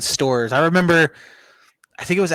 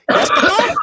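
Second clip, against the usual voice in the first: very high, squeaky mock child's question; 4 dB louder than usual. Customer teasing the guy at the register, squeaky. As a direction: pipe it up high like a squeaky kid. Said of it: "in, like, a squeaky voice".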